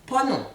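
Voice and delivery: Ponon said high and clear, falling at the end; pronunciation demo. Shown as a demonstration. Teacher after "listen to the pronunciation", saying Ponon clearly. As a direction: model Ponon for the class to repeat. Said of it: "Écoutez la prononciation".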